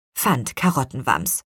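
usual speaking voice

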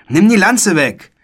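high, loud order; indignant call. A narrator's reading. Boy threatened by a lance, calling out loud and high. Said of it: "rief Philipp".

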